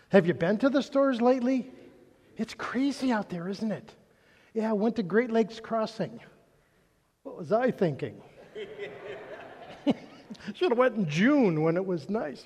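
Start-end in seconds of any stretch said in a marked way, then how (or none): none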